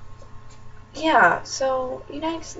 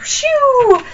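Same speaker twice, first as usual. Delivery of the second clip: much higher, loud squeaky cat voice; 13 semitones higher, 9 dB louder than usual. Retold or imitated. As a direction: squeal it like the little cat would. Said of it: "she was like".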